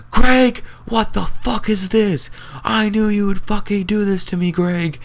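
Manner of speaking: shrill, high, loud furious shriek at her son; furious mom yell. Retold or imitated. Mom discovering the party, screaming at Greg in fury. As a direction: screech it like an enraged mother.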